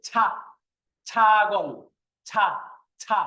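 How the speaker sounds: ছা and ছাগল with a pressed, breathy burst on "chh"; forceful aspiration. Shown as a demonstration. Teacher showing students the pressure, ছা strongly aspirated. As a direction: push hard on the ছ, releasing lots of air. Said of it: "একটু জোর দিয়ে".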